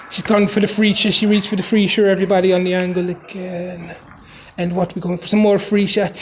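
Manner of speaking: jokey put-on Irish Jamaican accent; comic commentary in an Irish-Jamaican mash-up accent. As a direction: narrate in a mock Irish brogue with Jamaican slips. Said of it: "narrate it for her in my Irish Jamaican accent".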